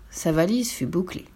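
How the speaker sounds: ordinary delivery, nothing marked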